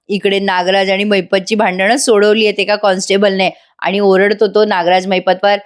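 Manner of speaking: ordinary talk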